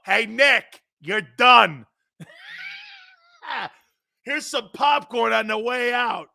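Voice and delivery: loud, high jeering shout; heckling fan. Retold or imitated. Tampa fan heckling coach Nick on his way out. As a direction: taunt him like a heckler. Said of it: "the guy in the stands".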